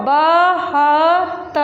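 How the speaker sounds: बहत्तर drawn out very slowly, syllable by syllable; blended reading. Shown as a demonstration. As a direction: stretch बहत्तर slowly as if sounding it out for children. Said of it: "मिला के पढ़ो".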